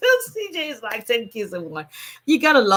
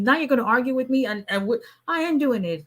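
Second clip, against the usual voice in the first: ordinary talk, nothing marked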